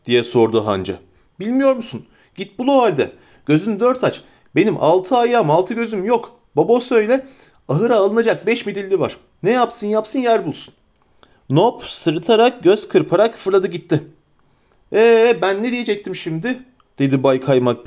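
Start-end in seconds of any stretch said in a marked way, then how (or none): 1.4-10.7 higher, eager innkeeper voice at a quick clip; a flustered, fussing landlord; bustling innkeeper. Acted. Innkeeper Butterbur welcoming the hobbits, eager and fussy. 14.9-16.6 higher, eager innkeeper voice at a quick clip; a flustered, fussing landlord; bustling innkeeper. Acted. Innkeeper Butterbur welcoming the hobbits, eager and fussy.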